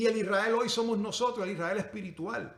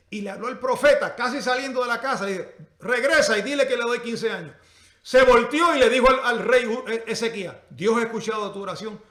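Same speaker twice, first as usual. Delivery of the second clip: loud, raised storytelling voice; animated preaching; 5 semitones higher, 12 dB louder than usual. Real, not acted. Preacher retelling God sending the prophet back, animated preaching. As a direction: tell it urgently at full pulpit force.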